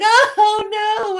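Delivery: put-on loud, very high childish wail; mock horrified cry. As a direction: wail like a horrified little kid. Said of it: "And I started crying".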